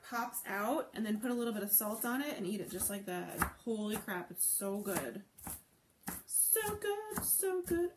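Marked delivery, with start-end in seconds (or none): 6.2-7.9 delighted. Real, not acted. Tasting the plantain.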